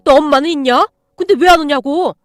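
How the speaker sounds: put-on loud, contemptuous yelling at a kid; bully him with a furious shout. An angry father yelling insults at a boy in a police station, loud and furious.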